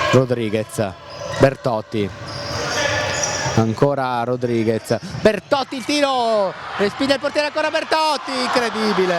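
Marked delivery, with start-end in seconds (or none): 5.2-9.2 high, urgent play-by-play; rising tension on the rebound; excited commentary. Real, not acted.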